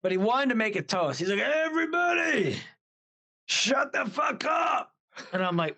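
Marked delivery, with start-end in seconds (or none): 1.4-4.9 high mock yell, slowly stretched out; retold shout. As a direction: imitate hollering over a noisy party. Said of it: "music is blasting at this point".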